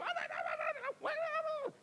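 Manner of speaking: very high-pitched, frantic run of syllables; imitated unstoppable babbling. Congregants carrying on after the amen, high mimicked babble. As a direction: mimic worshippers babbling on regardless. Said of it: "Di sana sini masuk".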